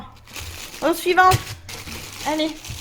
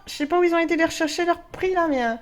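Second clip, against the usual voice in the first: ordinary delivery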